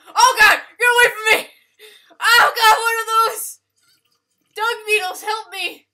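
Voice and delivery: loud, high cries for help; real high-pitched panic yell; panicked screaming. Real, not acted. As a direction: scream in alarm at attackers. Attacked in game, calls for help.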